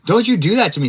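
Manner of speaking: mock scolding. Real, not acted. Scolding his teammate after a scare, playful mock scold. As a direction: tell her off in jest, loudly.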